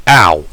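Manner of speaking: pained groan. Acted. Waking up with head pain.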